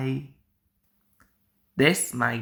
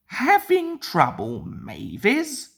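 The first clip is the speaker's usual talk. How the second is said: chortling; 7 semitones higher than usual. A narrator's reading. Toby teasing stuck Mavis with glee. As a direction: gloat with a chuckle. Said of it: "chortled Toby".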